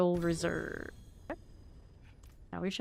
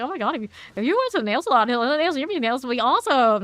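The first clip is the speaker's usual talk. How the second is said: fast, louder mock Vietnamese nail-salon accent; imitating Vietnamese aunties' accent; 6 semitones higher, 8 dB louder, faster than usual. Vietnamese relatives pushing nails, loud mock Vietnamese accent. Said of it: "I say the whole accent and everything".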